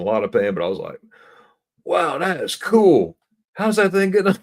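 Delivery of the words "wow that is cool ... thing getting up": retold awe, pitched way up and loud; amazed. Retold amazement despite being in pain.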